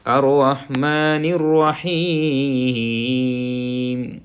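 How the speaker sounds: slow, drawn-out tajweed recitation; Quranic recitation. Shown as a demonstration. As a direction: chant each word slowly. (ٹھہر ٹھہر کر تلاوت)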